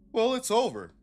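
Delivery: high, mocking sing-song of a quitter; squeaky put-on voice, mocking the quitter; mock resigned shrug. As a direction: act out someone giving up too easily. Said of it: "Y'all didn't just go".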